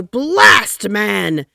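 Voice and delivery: very loud, high "Blast, man!"; furious shout. A narrator's reading. Taft shouting at the Secretary of State. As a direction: explode at him. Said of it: "shouted Taft".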